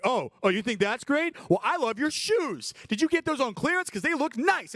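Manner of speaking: hammed-up sweet compliments with a bite; mock flattering. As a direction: friend fires back gushing over the shoes. Friend's compliment comeback.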